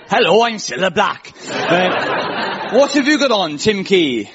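mock Welsh accent. Host asking the poet about his outfit, mock Welsh accent. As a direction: do a lilting Swansea accent as a TV presenter. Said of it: "we'll pretend Scylla's from Swansea".

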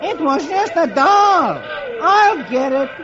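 high, slow comic soldier voice; sheepish cartoon soldier. A narrator's reading. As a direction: explain sheepishly, cartoon style. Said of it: "Beetle says".

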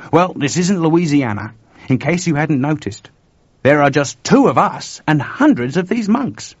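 uneasy Chuck retorting. Acted. Chuck reminds George they are outnumbered by monks, uneasy. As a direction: argue back with animated unease.

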